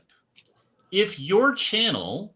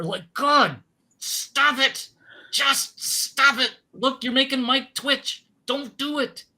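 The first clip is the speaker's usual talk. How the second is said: much higher, pleading stop it; exasperated; 9 semitones higher than usual. Real, not acted. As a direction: beg them to stop, fed up.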